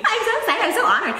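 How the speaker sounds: high, giggly excitement; giddy and laughing. Real, not acted. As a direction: squeal it out through a laugh. Guest gushing, laughing.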